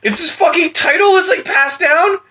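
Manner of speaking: bewildered ranting through laughs; agitated. Real, not acted. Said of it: "You're getting, like, agitated again".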